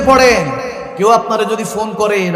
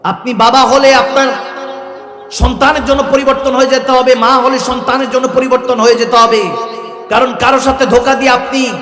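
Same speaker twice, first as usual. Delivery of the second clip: higher and louder, pounding fiery preaching; preaching at full force; 5 semitones higher, 4 dB louder than usual. Acted. Preacher rousing the mosque crowd, fiery preaching. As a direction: drive each line home to the crowd.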